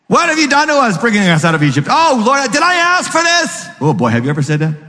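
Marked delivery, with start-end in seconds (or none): 0.1-3.7 complaining Israelites. Retold or imitated.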